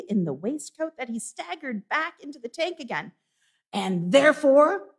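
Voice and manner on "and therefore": higher and loud on "therefore"; mock threat, repeated. Scrooge teases the trembling clerk, loud and mock threatening. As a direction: pause on it to keep him squirming.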